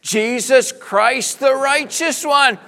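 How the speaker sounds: slow proclamation. Shown as a demonstration. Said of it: "Now both of them".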